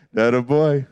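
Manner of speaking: low, approving aside; secretly proud. Retold or imitated. Dad secretly admiring son's prank, low proud voice. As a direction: savour it as a proud dad, pitch dropped. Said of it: "part of me was like".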